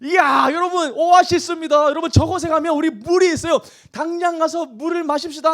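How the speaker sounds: high-pitched, elated cry to the crowd; excited discovery. Acted. Preacher as thirsty Israelites sighting an oasis, excited. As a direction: shout it like you just spotted water. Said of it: "어떤 마음이 듭니까".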